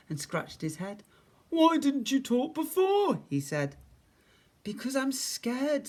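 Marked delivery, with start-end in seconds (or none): 1.5-3.2 high, loud bear voice; puzzled bear. A narrator's reading. 4.6-5.9 put-on high, slow, shy child's voice; timid little voice. A narrator's reading. Timid voice answers the bear.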